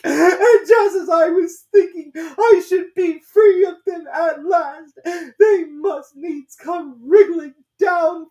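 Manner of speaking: rising into a very high, loud, slow shriek. A narrator's reading. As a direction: build the complaint into a scream. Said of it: "raising its voice to a shriek".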